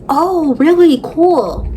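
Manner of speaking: a hint of a bubbly woman, shrugging it off; imitating her airy reply. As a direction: toss it off like the clueless wife. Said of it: "She's like".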